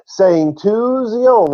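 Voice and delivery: "saying to Zion" very slow and high; emphatic repeat. Real, not acted. Teacher stressing the most important part, emphatic. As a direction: hammer out "saying to Zion", drawn out.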